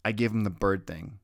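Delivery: ordinary delivery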